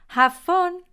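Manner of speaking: high, loud sing-song; playful. A narrator's reading. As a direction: send them off gleefully. Amelia calling out playfully.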